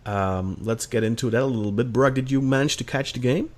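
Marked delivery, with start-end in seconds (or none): none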